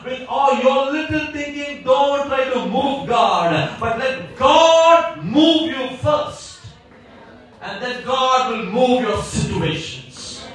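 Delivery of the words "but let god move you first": slow, raised and deliberate; slow emphatic proclamation. Real, not acted. Preacher urging the church, slow and raised. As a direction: spell it out slowly like a command. Preacher slows key line.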